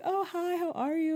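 very high, lilting hello; cheery greeting back. Retold or imitated. Returning a neighbor's morning greeting, high cheery hello. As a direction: answer a neighbor in a sweet high voice. Her usual reply to the neighbor.

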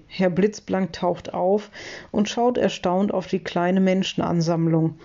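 ordinary delivery

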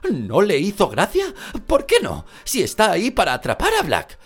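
high loud disbelief; shocked and indignant questions; surprised, indignant. Acted.

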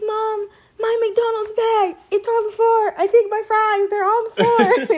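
mother imitating her daughter's tearful panic in the car; panicky little girl. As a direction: wail it like a kid whose fries spilled. Daughter panicking, voiced by mom.

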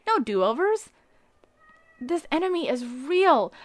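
ordinary talk

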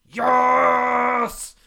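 high, loud triumphant shout; cheering shout. Retold or imitated. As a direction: whoop it out. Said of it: "and it was like".

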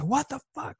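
high-pitched, held-back mock yell; mock shouting. Parent retelling snapping at his son, mock shouting. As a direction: act out a shout without the volume. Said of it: "I shouted, I said".

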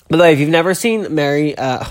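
ordinary delivery

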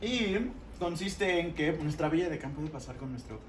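high, loud announcer voice; game show host. Acted. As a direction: present it like a TV host.

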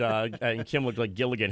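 ordinary talk, nothing marked

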